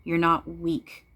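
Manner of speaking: normal talking voice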